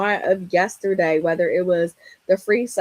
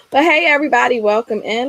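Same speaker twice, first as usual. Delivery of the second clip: loud, high, upbeat hello to the audience; bright stream-host welcome, higher and louder; excited greeting; 5 semitones higher, 8 dB louder, faster than usual. Real, not acted. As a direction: welcome everyone with big energy. Host re-greeting viewers on live.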